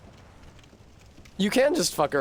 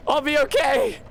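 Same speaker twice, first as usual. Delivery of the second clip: much higher, louder squeaky voice; strained high squeak; 4 dB louder than usual. Pretend. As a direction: squeak it out, forced cheer. Forced high voice after laughing.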